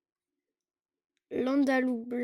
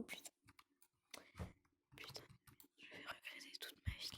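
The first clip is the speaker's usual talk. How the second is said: whispered regret aside; whispering; 26 dB quieter, faster than usual. Real, not acted. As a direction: whisper it to yourself. Muttered aside while on phone.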